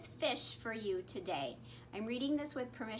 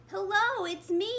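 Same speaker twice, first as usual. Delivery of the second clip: higher, louder call; 9 semitones higher, 6 dB louder than usual. A narrator's reading. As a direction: call out happily to friends. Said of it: "he called".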